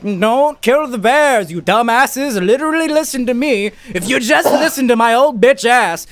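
put-on high-pitched crotchety old woman; hammed-up ancient granny. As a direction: scold them in a high mock-granny voice. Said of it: "there's, like, this fucking granny bitch".

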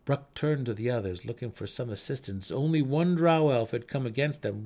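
ordinary delivery

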